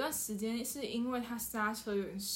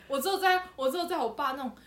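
ordinary talk

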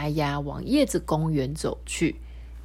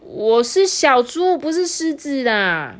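higher, louder, slow blurt; blurted shout; 8 semitones higher, 6 dB louder, slower than usual. Acted. Hulu blurting to his friends, loud. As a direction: blurt it out in a panic. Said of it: "脱口喊说".

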